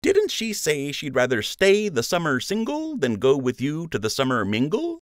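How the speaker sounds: smirky sidekick tease; smirking friend. A narrator's reading. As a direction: rub it in with a grin.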